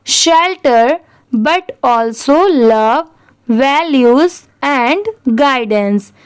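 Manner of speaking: very slow, word by word; slow, deliberate model reading; repeat-after-me reading. Shown as a demonstration. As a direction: leave a pause after each word for learners. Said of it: "अब चलिए ये पढ़ते हैं".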